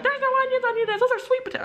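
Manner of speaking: very high-pitched mimicked children; protesting kids. Students contradicting their tearful teacher, high-pitched protest.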